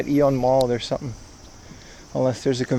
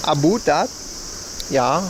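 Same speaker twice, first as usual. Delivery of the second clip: "About" said as "a-boot", rising; lightly put-on Canadian accent; joking Canadian accent; mock Canadian accent; 4 dB quieter than usual. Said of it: "That was my Canadian accent".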